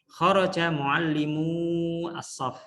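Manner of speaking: Kharaja muallimu as-saff read very slowly and carefully; slow, deliberate Arabic dictation; slow model reading. Shown as a demonstration. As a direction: read it out like a teacher modelling for the class. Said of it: "baca ini guru ya harus bagus".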